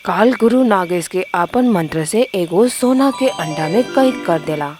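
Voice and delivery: usual speaking voice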